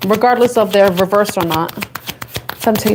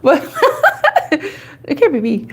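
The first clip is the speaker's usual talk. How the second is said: laughing denial; 8 semitones higher, slower than usual. Retold or imitated. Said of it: "I'm like".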